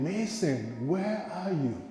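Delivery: a mother calling her son, growing frightened; worried calling. Retold or imitated. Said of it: "And she kept calling".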